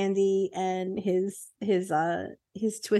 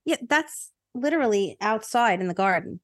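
ordinary talk, nothing marked

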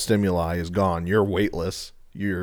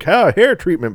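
higher, louder huckster pitch; old-timey salesman; 10 semitones higher, 4 dB louder than usual. Retold or imitated. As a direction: hawk it like a snake oil salesman.

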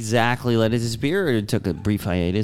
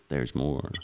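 low, slow announcer voice; deep promo voice; 11 semitones lower, 10 dB quieter than usual. Acted. Cohost echoing the ad line in a deep announcer voice. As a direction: drop deep and drawn out like a TV ad.

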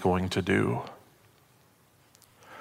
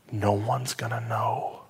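reassuring whisper; 4 dB quieter than usual. Retold or imitated. Friend soothing Chris at night.

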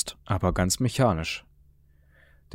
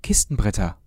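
called out; 8 semitones higher, 4 dB louder than usual. A narrator's reading. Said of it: "rief er".

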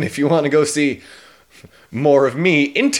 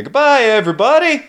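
loud goodbye; 10 semitones higher, 6 dB louder than usual. Real, not acted. Loud sign-off.

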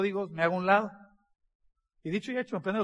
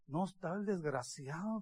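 muttered aside; 3 semitones lower, 14 dB quieter than usual. Retold or imitated. Retold muttered complaint to himself.

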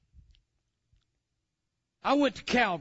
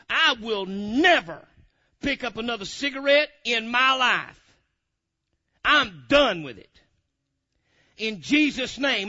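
slow, louder, each word set down; firm vow; 3 semitones higher, 6 dB louder, slower than usual. Retold or imitated. Preacher rebuking the devil, slow firm declaration.

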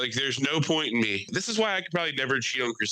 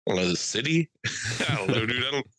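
ordinary talk, nothing marked

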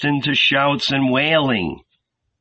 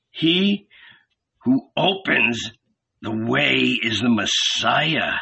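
growling; slower than usual. A narrator's reading. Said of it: "the red beard growled".

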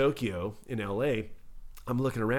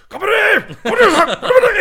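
much higher, louder barking shout; samurai-style yell; 14 dB louder than usual. Retold or imitated. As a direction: bellow like a samurai rallying men. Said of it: "yelling at them in Japanese".

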